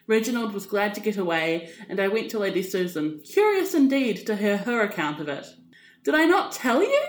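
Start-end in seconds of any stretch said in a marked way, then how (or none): 6.0-7.0 smug smiling lift, higher; a knowing, self-satisfied smile in the voice. Acted. Lady Susan teasing Catherine, smiling and smug. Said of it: "she said with a smile".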